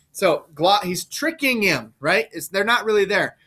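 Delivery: ordinary talk, nothing marked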